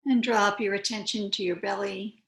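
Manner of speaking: unhurried yoga teacher, long pauses between phrases; calm, spacious meditation voice; guided meditation. Real, not acted. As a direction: guide them gently into stillness, phrase by phrase.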